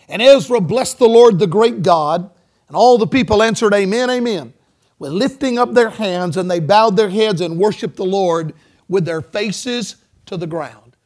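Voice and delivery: loud, ringing Bible reading; scripture reading. Real, not acted. Preacher reading Ezra's story to congregation, loud scripture reading. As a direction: proclaim the passage to the whole church.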